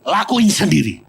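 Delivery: perintah keras dan tegas; marah. Retold or imitated. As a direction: bentak dengan nada marah. (pendeta menyuruh suami istri dengan marah)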